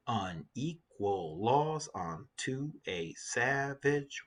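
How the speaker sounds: measured syllable-by-syllable reading; counting out syllables. Shown as a demonstration. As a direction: spell the verse out beat by beat. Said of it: "let's count the syllables in the lines".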